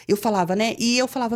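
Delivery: usual speaking voice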